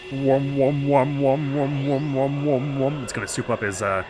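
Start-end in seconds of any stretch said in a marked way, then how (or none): 0.1-3.0 rhythmic wum-wum machine noise; imitated whooshing hum. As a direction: hum like a charging device.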